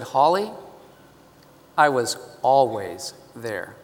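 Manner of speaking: put-on calm the hurting child with quiet love; gentle reassuring voice. God speaking to Holly, soft and reassuring. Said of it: "Soft, gentle, but firm, loving, reassuring".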